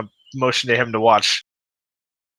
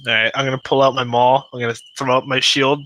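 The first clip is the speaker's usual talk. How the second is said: ordinary talk, nothing marked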